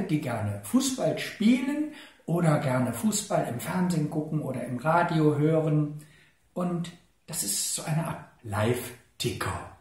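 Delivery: ordinary talk, nothing marked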